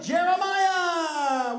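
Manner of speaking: high, drawn-out stage call; long announcer-style holler of the name. Pretend. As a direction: summon someone from the crowd. Calling a prophet to the stage.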